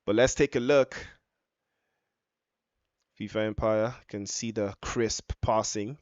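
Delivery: ordinary talk, nothing marked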